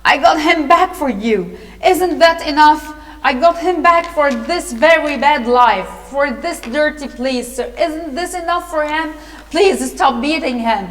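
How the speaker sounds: starts loud, insistent appeal; acted protest. A narrator's reading. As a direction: plead loudly on the boy's behalf. Nancy's acted protest for Oliver.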